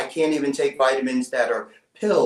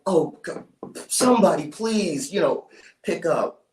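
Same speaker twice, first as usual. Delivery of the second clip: re-enacted frantic plea, voice rising high; urgent plea that eases off at the end; desperate pleading; 4 semitones higher than usual. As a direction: beg for someone to answer. Retold desperate plea into phone.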